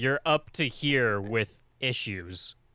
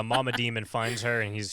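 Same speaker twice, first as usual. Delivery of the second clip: normal talking voice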